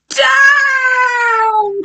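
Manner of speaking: high, drawn-out falling shout; witch's cry. A narrator's reading. Witch calling her broomstick riders down, long high cry. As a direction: call out the command like an alarmed storybook witch. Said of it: "Cried the witch".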